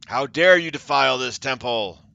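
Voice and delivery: loud, indignant demand; angry challenge. Acted. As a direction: throw the outrage at her. Commander confronts the sorceress.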